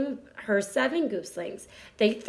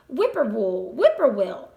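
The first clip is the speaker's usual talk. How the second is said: sung-out bird call, falling on each word, the second louder; 6 dB louder than usual. A narrator's reading. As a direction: call it like a whippoorwill across the road. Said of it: "a bird sang".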